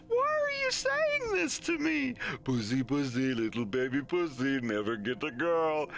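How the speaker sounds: whiny customer. Retold or imitated. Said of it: "mimicking the customer".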